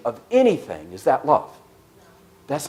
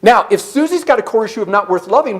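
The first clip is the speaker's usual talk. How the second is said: ordinary delivery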